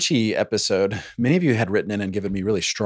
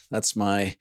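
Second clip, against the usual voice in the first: usual speaking voice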